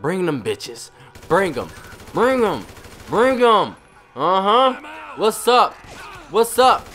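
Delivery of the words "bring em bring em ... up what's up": taunting. Pretend. Taunting the police.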